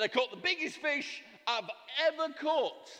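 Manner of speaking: high, proud boast about the catch; boastful husband. Acted. Husband bragging to his wife, high and proud.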